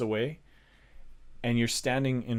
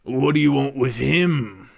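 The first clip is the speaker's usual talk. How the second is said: gruff guard; 3 semitones higher, 11 dB louder than usual. Acted. Guard questioning the party at the gate, loud. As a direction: challenge the strangers from the wall.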